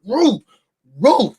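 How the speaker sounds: high barking roo roo; mock dog barking; dog bark imitation. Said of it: "it said".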